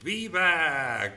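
loud, high cheer; shouted viva. Real, not acted. Streamer and chat cheering San Luis Potosí, shouting. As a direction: belt it out for everyone. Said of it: "y todos decimos".

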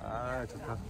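exaggerated pleased sigh; put-on contentment; fake satisfaction. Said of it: "되게 가식적인데".

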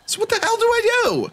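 high, helpless outburst; exasperated cry. Real, not acted. Lost player finds no way through, exasperated cry.

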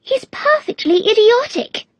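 put-on very high, exasperated little-girl voice; desperate Alice. A narrator's reading. Alice dismisses the footman, desperately exasperated. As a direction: pronounce judgement in high girlish despair. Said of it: "said Alice desperately".

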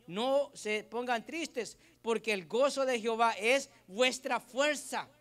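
preacher proclaiming the verse to the congregation; scripture reading. Acted. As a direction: declaim the verse to the church. Reads Nehemiah 8:10 aloud.

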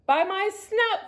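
high, drawn-out storybook cry; alarmed cry. A narrator's reading. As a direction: cry out as a startled little pig. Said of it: "cried Horace".

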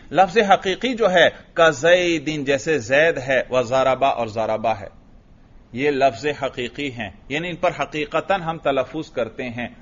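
ordinary delivery

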